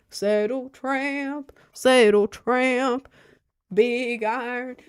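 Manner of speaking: Southern twang, drawn out like a cowboy song; southern accent. Pretend. Slow southern accent while humming old cowboy songs. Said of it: "I don't know why that was in a southern accent".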